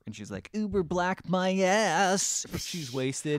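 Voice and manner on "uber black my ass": put-on slow slurred drunk woman. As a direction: drag it out like you're wasted. Retelling drunk lady.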